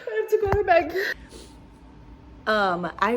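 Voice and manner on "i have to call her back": high, loud, laughing through tears; real happy crying voice; tearful elation. Real, not acted. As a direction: blurt it out, overcome with joy. Overwhelmed after learning she passed.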